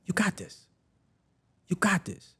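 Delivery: high, soft imitation of mom; reassuring mother. Mom encouraging her son, soft high reassurance. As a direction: lift it gently, pausing between phrases. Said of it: "She says".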